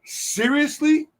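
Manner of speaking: slow, high-pitched disbelieving question; incredulous. Retold or imitated. Reacting to his friend signing a woman's breast, incredulous. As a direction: draw it out in mock disbelief.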